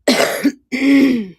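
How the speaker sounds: a loud dry cough. Real, not acted. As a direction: cough hard into the mic. Sick voice, coughing.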